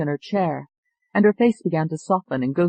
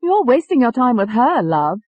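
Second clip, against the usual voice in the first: put-on higher, dismissive English-accented voice; English-accented Tony; 7 semitones higher, 6 dB louder than usual. A narrator's reading. Tony breaks in on the doctor, dismissive English accent. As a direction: cut in with a cocky English accent. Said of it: "There was that English accent".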